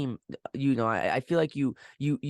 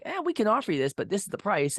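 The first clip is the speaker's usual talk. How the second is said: higher-pitched, offhand mimicry of rival companies; dismissive company quote; 8 semitones higher than usual. Interviewer mimicking other companies to his guest, dismissive. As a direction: imitate a take-it-or-leave-it company pitch. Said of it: "been like".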